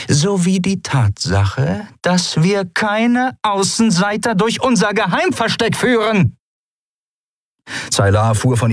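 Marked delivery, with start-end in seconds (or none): 0.1-6.4 higher, slow and pointed accusation; stern captain accusing. A narrator's reading. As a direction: lay the trap shut, deliberately. Zaylar reveals her accusation.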